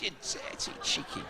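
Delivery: whispered insult. Retold or imitated. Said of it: "I just said".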